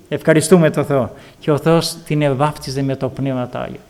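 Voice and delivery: ordinary talk, nothing marked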